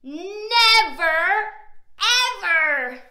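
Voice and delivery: loud, drawn-out troll refusal; refusing troll. A narrator's reading. Troll refusing to let Little Billy cross, booming. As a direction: boom it out as the troll.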